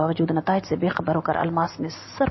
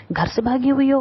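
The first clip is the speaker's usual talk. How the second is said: higher and louder, sudden alarmed question; 7 semitones higher, 4 dB louder than usual. Acted. Policeman suddenly alarmed, asking if she ran away from home. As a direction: blurt it out in alarm. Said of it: "वो कुछ घबरा कर बोला था".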